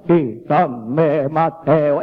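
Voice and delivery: mock the slow-motion Hollywood Christ; slow-motion movie Jesus. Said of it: "que solo habla en cámara lenta". (Cristo de Hollywood en cámara lenta, grave y arrastrado)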